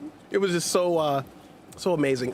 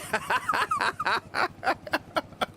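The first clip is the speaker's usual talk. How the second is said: high laugh; 3 dB quieter than usual. Real, not acted. Laughing at game moment.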